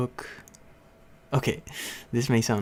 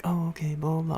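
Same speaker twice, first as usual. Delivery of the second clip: high, sing-song polite brush-off; mock-polite kid brushing off the teacher; fake polite; 7 semitones higher than usual. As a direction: pretend to be agreeable, higher up. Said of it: "I was acting so polite".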